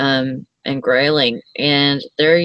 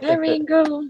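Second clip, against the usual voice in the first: high squeaky greeting; 13 semitones higher than usual. Real, not acted. Greeting the puppy.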